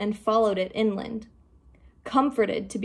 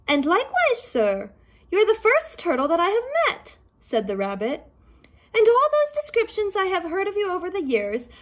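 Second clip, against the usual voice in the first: much higher, bright fast rabbit chatter; high, quick voice of an excitable rabbit; 10 semitones higher than usual. Acted. The rabbit marveling at the turtle, high and quick.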